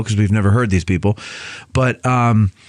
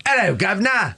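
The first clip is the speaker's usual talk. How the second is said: high, softer hammed-up Cockney cabbie; mock Cockney cabbie; 9 semitones higher, 3 dB quieter than usual. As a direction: play a cheeky Cockney taxi driver. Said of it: "the taxi driver didn't go".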